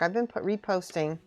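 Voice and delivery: usual speaking voice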